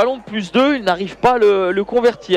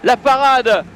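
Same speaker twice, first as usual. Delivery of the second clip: excited play-by-play; 6 semitones higher than usual. Real, not acted. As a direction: call the final save with full excitement. Final save and final whistle.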